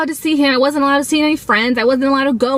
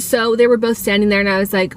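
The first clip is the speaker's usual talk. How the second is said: ordinary delivery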